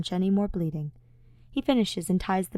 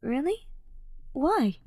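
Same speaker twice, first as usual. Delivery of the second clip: curious Ash; 8 semitones higher, slower than usual. Acted.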